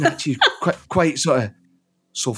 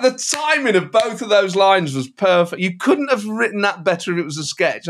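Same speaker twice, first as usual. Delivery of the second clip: ordinary delivery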